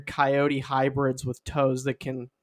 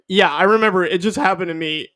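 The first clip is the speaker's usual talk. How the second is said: loud, higher indignant retort; exasperated; 4 semitones higher, 5 dB louder, faster than usual. Retold or imitated. Crash victim answering an insensitive question, mock exasperation. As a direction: snap back in mock exasperation.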